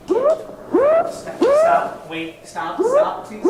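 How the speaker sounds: high panicked yells; shouting stop. Real, not acted. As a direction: yell to make it stop. Shouting at spinning wheel.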